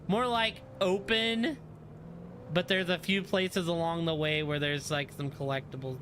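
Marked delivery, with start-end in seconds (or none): none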